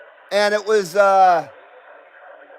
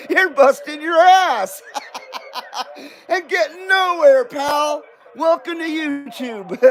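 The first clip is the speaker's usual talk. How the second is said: higher voice jeering through laughs; mocking laughter; 6 semitones higher than usual. Analytics jeering at the struggling channel owner, mocking laughter. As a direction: taunt him, cackling between the phrases. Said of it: "the analytics are laughing at me".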